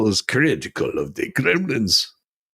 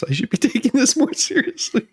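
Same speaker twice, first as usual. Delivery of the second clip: laughing; 8 semitones higher than usual. Real, not acted. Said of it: "I shouldn't be laughing".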